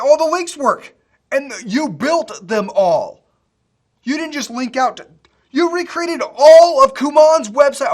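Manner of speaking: loud and thrilled, slowing down; overwhelmed with delight; excited. Real, not acted. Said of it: "I need to calm down".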